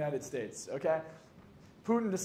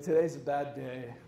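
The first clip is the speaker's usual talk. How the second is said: slow, low mock gloom; downcast patient; 5 semitones lower, slower than usual. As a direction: voice a glum patient, dragging it out. Retold patient arriving pale with bad news.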